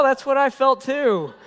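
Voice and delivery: ordinary talk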